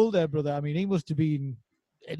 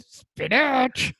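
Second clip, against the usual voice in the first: high Popeye voice; vintage cartoon voice; 7 semitones higher than usual. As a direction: do the old sailor cartoon voice. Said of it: "an impression of a cartoon character".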